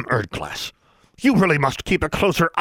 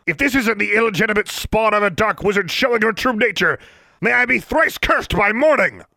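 much higher, louder ranting official; bellowing; 4 dB louder than usual. Acted. Inspector denounces the schoolgirl, bellowing in outrage. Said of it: "bellowed Mister Brown".

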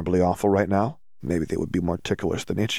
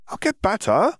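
woozy protest; 13 semitones higher than usual. Acted. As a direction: drift through it like she's floating. Dazed Hermione.

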